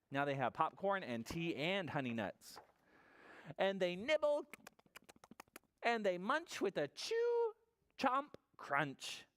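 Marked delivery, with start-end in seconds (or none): 3.6-9.2 slow, playful read-aloud with high peaks; storybook narration. A narrator's reading. Storyteller on animals munching as bear sleeps, playful and drawn out. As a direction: savour the munching words, then sink into the refrain.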